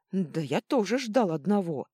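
yelling back. A narrator's reading. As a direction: holler back in exasperation. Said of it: "завопил в ответ мэтр Жужжин". (вопль в ответ)

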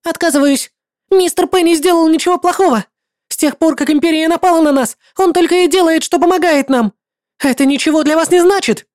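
high, loud, fast pleading defence; a young girl standing her ground, shouting; girl defends her friend to the paladin, loudly and high; desperate girl shouting. Acted. Kira defends Mister Penny.

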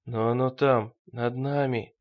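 usual speaking voice

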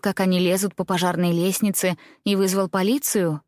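ordinary delivery, nothing marked

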